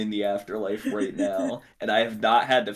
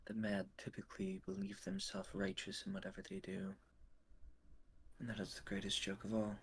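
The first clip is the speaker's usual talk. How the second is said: quiet ominous voice; 17 dB quieter than usual. Acted. In-character musing on madness.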